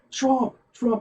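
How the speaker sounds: high-pitched mock crowd guess; imitated audience shouting answers. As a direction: toss out the guess like an eager audience. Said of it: "they're all like".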